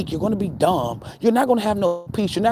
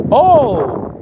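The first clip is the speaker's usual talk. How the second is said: mock realization; 3 semitones higher, 7 dB louder than usual. Acts out the divine tallying charges.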